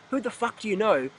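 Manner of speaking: ordinary delivery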